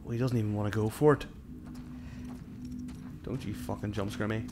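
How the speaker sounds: ordinary delivery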